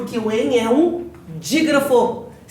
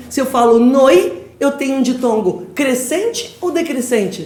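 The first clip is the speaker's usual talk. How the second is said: ordinary delivery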